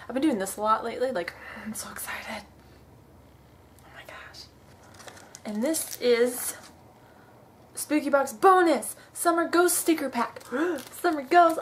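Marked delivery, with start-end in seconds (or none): 1.1-4.4 excited whisper; breathless whispered thrill. Real, not acted. Overwhelmed unboxing spooky box. 10.5-10.9 sharp excited gasp; a quick thrilled intake of breath; delighted gasp. Real, not acted. Sees Summer Ghost stickers.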